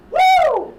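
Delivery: loud high-pitched "woo"; sudden cheer. Acted. Golf fan on the couch cheering the shot, loud whoop. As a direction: let out an explosive high whoop. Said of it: "Someone next to me goes".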